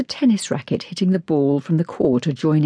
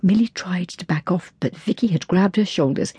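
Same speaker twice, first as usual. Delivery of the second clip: usual speaking voice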